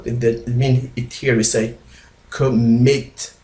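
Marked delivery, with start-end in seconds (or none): 2.3-3.3 "commit" with the second syllable punched; stress on last syllable. Shown as a demonstration. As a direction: lean on the MIT of "commit". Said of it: "here we make it stress, we be speaker higher".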